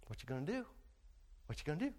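soft, low questioning; the Lord asking softly. Acted. The Lord gently testing Peter, soft and low. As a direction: ask it quietly, gently testing. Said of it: "and the Lord says".

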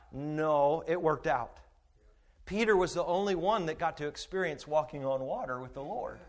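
usual speaking voice

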